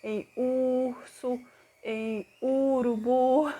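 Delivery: "urso" and "urubu" slow, U rounded and stretched; teacher modelling the U sound slowly; drawn-out U. Shown as a demonstration. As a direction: linger on the U of "urubu", lips pushed forward. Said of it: "ou mesmo da vogal U".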